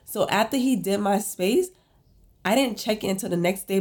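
normal talking voice

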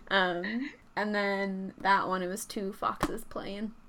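ordinary talk

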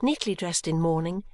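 usual speaking voice

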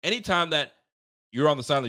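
ordinary talk, nothing marked

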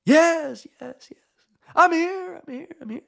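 high shout, then fading repeats; mock echo, loud to faint; yelling back with echo. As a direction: call up from a pit and echo yourself. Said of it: "yells back".

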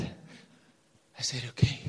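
very soft, low whispered reply; timid whisper. Retold or imitated. Shy young man giving in to God, whispered. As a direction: whisper a meek agreement.

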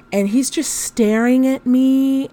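normal talking voice

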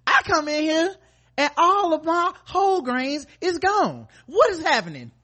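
high, loud outraged parent; yelling across the room. Pretend. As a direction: shriek it like a furious mom. Mock parent finding healthy food.

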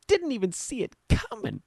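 a quick, soft nod to the Dumb and Dumber delivery; Jim Carrey movie quote. Retold or imitated. Host quoting Dumb and Dumber to listeners, light Jim Carrey impression. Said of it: "It's like the line from".

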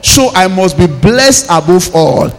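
loud, insistent preaching declarations; =forceful, ringing revival prayer; full-force prayer. Acted. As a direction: hammer out each declaration for the congregation.

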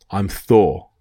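usual speaking voice

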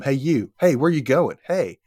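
mild mock call across a room. Some guy calls across the shelter room, lightly acted hail. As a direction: imitate someone hailing from afar, lightly. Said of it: "starts calling from across the room".

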